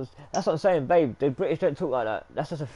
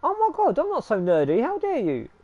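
mock outrage; 11 semitones higher, 5 dB louder than usual. Mock outrage at being called nerdy.

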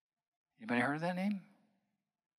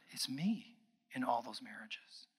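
hushed whisper; 2 semitones lower, 8 dB quieter than usual. Retold or imitated. Client realizing, retold in whisper.

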